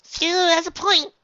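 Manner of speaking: put-on very high girly voice; high sweet filly. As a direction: chirp it agreeably.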